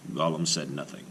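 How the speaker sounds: usual speaking voice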